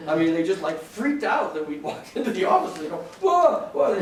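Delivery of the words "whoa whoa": high, loud startled cry; panicked whoa. Retold or imitated. Secretary panicking as the lawyers walk in, loud and high.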